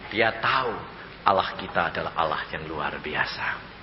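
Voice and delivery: ordinary talk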